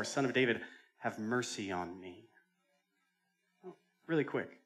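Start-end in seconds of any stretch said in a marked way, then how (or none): none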